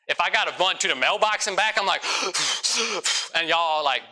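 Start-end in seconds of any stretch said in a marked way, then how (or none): 2.1-3.3 sharp gasp for air, out of breath; winded gasp. Retold or imitated. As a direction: gasp like you ran to the mailbox. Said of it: "I'm out of breath".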